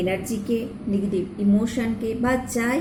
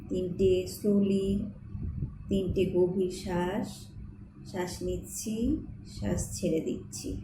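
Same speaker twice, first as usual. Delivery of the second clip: softer, unhurried meditation voice with long pauses; guided meditation; 6 dB quieter than usual. Acted. Host guiding listeners through Archangel Michael healing prayer, meditative.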